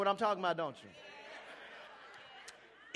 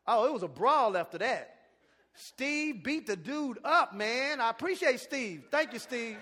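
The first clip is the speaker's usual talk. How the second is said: ordinary talk, nothing marked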